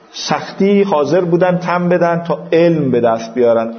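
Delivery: ordinary talk, nothing marked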